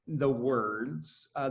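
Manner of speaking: ordinary talk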